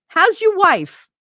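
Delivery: high, loud, drawn-out call; grandma's pointed greeting. Retold or imitated. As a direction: imitate grandma calling out a loaded greeting. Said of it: "She'd always make sure".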